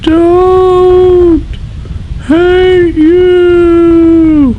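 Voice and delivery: put-on very high, loud child's cry; tiny child shouting. Tiny child calling to the king, high and loud. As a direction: shout it like a small kid. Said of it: "a tiny child rose".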